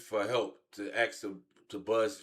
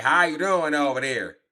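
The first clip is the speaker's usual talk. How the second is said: a hint of a put-on California accent, higher and louder; mock accent; 5 semitones higher, 7 dB louder than usual. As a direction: lightly imitate his California accent.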